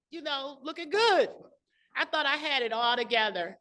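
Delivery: ordinary talk, nothing marked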